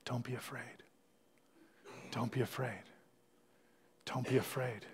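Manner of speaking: very soft, repeated gently; barely above a whisper, calming; whispering reassurance. Pretend. Pastor voicing Jesus to the congregation, whispered comfort. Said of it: "Jesus whispering to you".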